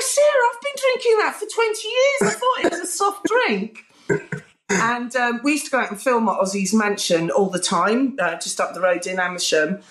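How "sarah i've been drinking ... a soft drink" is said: very high, wailing rock-star impression. Rock star learning red wine is alcoholic, high mock-baffled voice. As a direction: imitate a baffled rocker, pitched right up.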